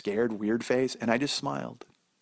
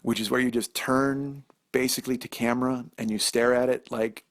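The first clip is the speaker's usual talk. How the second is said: ordinary delivery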